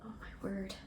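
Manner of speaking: very soft, low, wondering; awed murmur. Real, not acted. Staring at her homemade jam, soft awed murmur. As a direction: barely voice it in awe.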